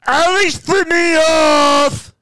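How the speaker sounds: very high, slow, loud tattletale shout; hammed-up outraged child yell; pissed-off little kid. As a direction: imitate a bratty kid screaming for mom. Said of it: "he gets pissed".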